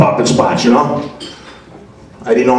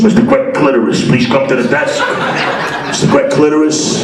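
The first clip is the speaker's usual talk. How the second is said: lightly mimicked paging announcer, a bit higher; airport loudspeaker announcer; 5 semitones higher than usual. Airport desk pages a passenger over the loudspeaker, mock announcer voice. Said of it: "looking for me on the loudspeaker".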